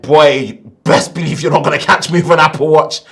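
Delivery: loud, fast New York Italian accent. Real, not acted. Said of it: "My New York Italian accent came in".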